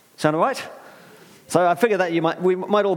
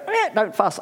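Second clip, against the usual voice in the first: higher parrot squawk; a hint of a nagging parrot; 6 semitones higher than usual. Parrot interrupting someone pouring out, higher squawk. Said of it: "when the parrot goes".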